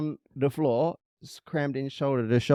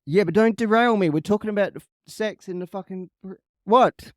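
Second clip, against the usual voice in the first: annoyed; 7 semitones higher, 6 dB louder than usual. Real, not acted. Telling co-host not to derail.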